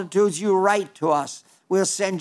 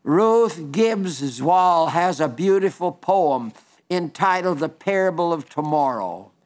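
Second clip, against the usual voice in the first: normal talking voice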